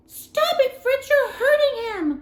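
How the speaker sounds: high, loud pleading cry; cried out. A narrator's reading. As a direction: cry out to stop him hurting it. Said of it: "cried Marie".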